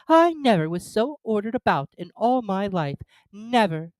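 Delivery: put-on indignant girl. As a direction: huff like an offended girl. Alice voiced by narrator.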